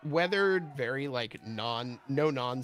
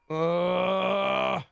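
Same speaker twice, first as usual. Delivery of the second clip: loud, higher held shout; mock shout; 7 semitones higher, 7 dB louder than usual. Game master calling out as a sailor, loud shout. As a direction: bellow a drawn-out uh.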